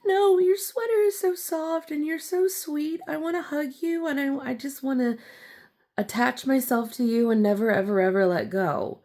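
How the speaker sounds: put-on high, sweet toddler pleading; little child voice. Toddler begging strangers to let her cling, high sweet plea. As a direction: coo like a small kid begging for a hug. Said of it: "'cause I'm like".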